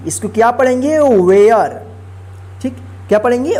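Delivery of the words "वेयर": वेयर said with the ए sounded and the last ई silent; pronunciation demo. Shown as a demonstration. Teacher showing pupils how to read वेयर. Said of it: "इस ए का ए उच्चारण होता है".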